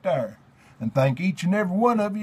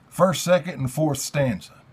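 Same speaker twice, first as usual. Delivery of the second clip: ordinary talk, nothing marked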